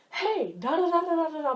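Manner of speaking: a touch of high, loud babble; mock chattering relative. Relative barging into your room, mock chattering. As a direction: lightly imitate a relative babbling on.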